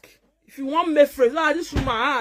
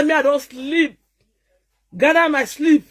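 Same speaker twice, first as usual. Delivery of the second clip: ordinary talk, nothing marked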